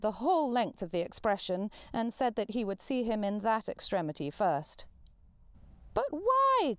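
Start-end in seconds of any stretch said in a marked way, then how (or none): 5.9-6.8 high, loud, bewildered outcry; incredulous cry. Acted. Nephew to Scrooge, stunned at his refusal, high cry. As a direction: nephew can't believe his ears. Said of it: "cried Scrooge's nephew".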